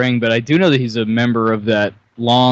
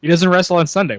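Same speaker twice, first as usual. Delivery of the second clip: ordinary talk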